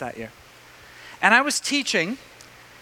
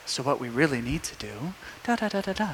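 soft, low imitation of the teacher; voice dropped low; 5 semitones lower, 11 dB quieter than usual. Older teacher calmly taking over the class, voice dropped low. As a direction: quietly carry on over the interruption. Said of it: "He drops his voice so low".

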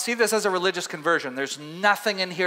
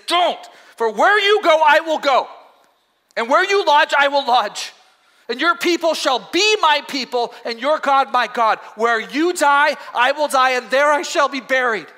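higher and louder, fervent promise; Ruth's passionate vow; 6 semitones higher, 5 dB louder than usual. Acted. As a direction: hold her face and swear loyalty. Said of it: "took her face in her hands and said".

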